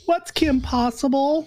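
put-on high, slow puzzled kid; confused kid voice. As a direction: play a baffled child. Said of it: "all these kids".

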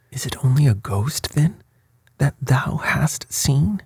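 high-pitched, mildly angry disbelief; enraged. A narrator's reading. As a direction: stretch out the question with exasperated scorn. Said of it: "cried Manfred in rage".

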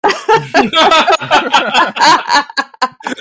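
bursting into a long, loud real laugh; cracking up hard at the joke. Real, not acted. Host's podcast joke lands.

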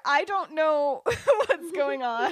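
high, loud, slowed-down laughing wail; hammed-up cluelessness, cracking up; mock flustered confusion. Kyra lost while friends draw wands, mock panic.

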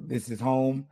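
normal talking voice

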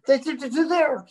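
ordinary delivery

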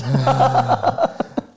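loud burst of laughter; real amused laughter. Real, not acted. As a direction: laugh out hard at the joke. Laughing at colleague remark.

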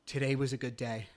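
usual speaking voice

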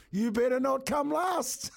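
high, slow, drawn-out mock warning; annoyed dad impression, pitched well up; imitated annoyance. Annoyed old man to his dawdling son, imitated warning. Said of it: "is really annoyed".

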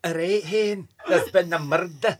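hammed-up Scottish detective; Scottish accent. Shown as a demonstration. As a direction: put on a broad, playful Scottish accent. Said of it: "Can you do a Scottish accent".

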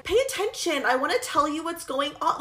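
lightly whiny plea for attention; mock whining. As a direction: imitate someone whining to be heard. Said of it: "almost, like, whining to them".

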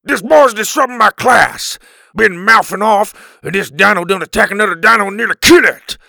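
loud, high hillbilly-style tirade; furious, hollering caveman teacher; angry rant. A narrator's reading. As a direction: rage out the accusation at full volume. Furious caveman teacher storms in accusing.

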